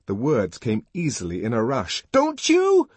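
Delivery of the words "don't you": very high, loud challenge; raging, insistent question; enraged repeated demand. A narrator's reading. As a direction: demand an answer from the crowd. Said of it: "he repeated".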